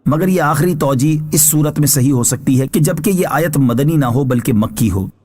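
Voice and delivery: ordinary talk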